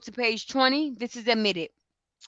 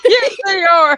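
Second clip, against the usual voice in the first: high and louder through laughter; laughing; 9 semitones higher, 11 dB louder than usual. Real, not acted. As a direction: agree while still laughing. Laughing agreement after laugh.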